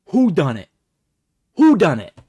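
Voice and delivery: loud, high mock-astonished outburst; mock shock. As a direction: cry it out in exaggerated disbelief. Mock shock at mystery presents.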